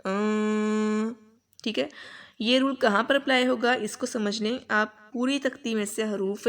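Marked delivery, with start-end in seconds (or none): 0.0-1.1 long drawn-out آں with the N hidden; hidden nasal N. Shown as a demonstration. Teacher demonstrates the ikhfa sound for students. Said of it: "اسے پڑھیں گے".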